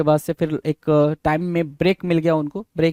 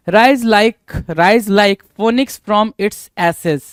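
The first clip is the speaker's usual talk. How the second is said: slow dictation; much slower than usual. Shown as a demonstration. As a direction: dictate the idiom slowly for students. Teacher dictates idiom slowly.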